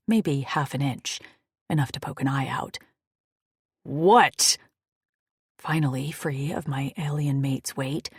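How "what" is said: loud gruff demand; angry. Acted. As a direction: bark it through the gap. Enraged, door opened an inch.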